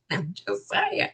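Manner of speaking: low, soft, tossed-off aside; offhand disclaimer. Acted. Host excuses own quip, soft low aside. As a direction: drop it softly under the breath. First, understated take of disclaimer.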